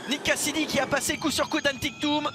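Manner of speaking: excited play-by-play. Real, not acted. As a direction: lift the voice to sell the move. Overtake called live, raised voice.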